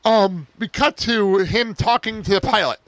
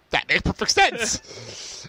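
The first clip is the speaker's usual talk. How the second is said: sarcastic; 5 dB quieter, much slower than usual. Real, not acted. Sarcastic aside on absurd plot.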